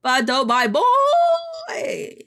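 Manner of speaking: high, loud, slow crying gush; delighted squeal. Real, not acted. Streamer gushing to chat over her new character. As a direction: squeal it out with love.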